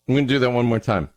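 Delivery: ordinary talk, nothing marked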